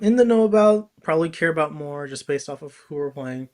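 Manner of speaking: ordinary talk, nothing marked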